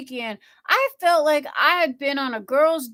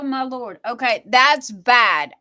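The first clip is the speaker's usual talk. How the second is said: usual speaking voice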